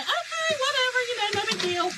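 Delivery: high, laughing brush-off; dismissive okay. Retold or imitated. To the installer, a high laughing brush-off. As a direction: shrug it off airily.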